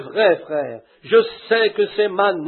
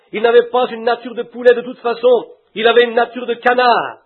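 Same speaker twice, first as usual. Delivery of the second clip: ordinary delivery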